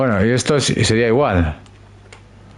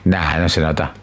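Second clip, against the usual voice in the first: low put-on voice; 7 semitones lower, slower than usual. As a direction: answer in a low character voice.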